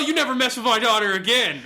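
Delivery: loud, fast mock yell of revenge; hammed-up vengeful war cry; retold yell. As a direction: art teacher player yelling at the burning troll, half-mock loud. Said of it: "I'll yell".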